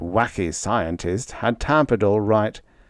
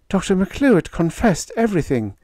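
higher, tremulous confession; 10 semitones higher than usual. A narrator's reading. Panda telling Freddie the truth, tremulous. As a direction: let the voice tremble. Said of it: "in a tremulous voice".

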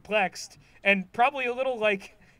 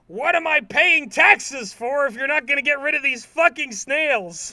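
higher, louder imitated angry outburst; hammed-up ranting at the town; furious Squidward rant; 4 semitones higher, 7 dB louder than usual. Squidward ranting about paying taxes for nothing, mock furious shout. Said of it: "he's just like".

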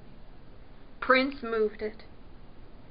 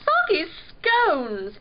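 slow, high, loud princely groan; dismayed groan; 5 semitones higher, 5 dB louder, slower than usual. A narrator's reading. Prince seeing a newcomer arrive, groaning. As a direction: groan the silly oath with royal dismay. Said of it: "Prince groaned".

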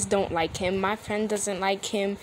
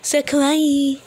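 "kawaii" pushed higher and louder in mockery; mock cute; 6 semitones higher, 8 dB louder, slower than usual. Mocking the clip again, louder.

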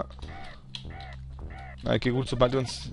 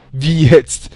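loud disbelief; 3 semitones higher, 12 dB louder than usual. Real, not acted. Item suddenly vanishes in game.

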